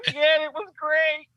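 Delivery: high, slow, delighted confession; gleeful admission. Retold or imitated. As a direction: savor the prank you pulled. Comic strip, Lantern gloating.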